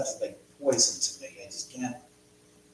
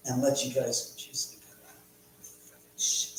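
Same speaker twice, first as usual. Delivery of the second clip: ordinary delivery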